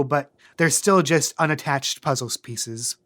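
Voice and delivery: ordinary talk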